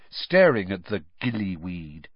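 plain reading voice

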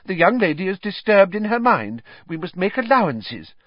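high-pitched, startled placating; smooth it over, somewhat taken aback. A narrator's reading. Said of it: "said Fudge, startled".

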